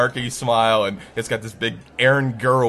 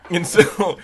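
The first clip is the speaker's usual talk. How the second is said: ordinary talk, nothing marked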